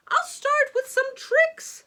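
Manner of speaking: high, loud eager showman; showman flourish. Acted. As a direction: announce the trick with flair. Magician begins show.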